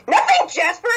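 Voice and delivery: high sudden shout; snapping shout. Acted. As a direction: bark it out to shut Jasper up. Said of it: "That scared the fuck out of me".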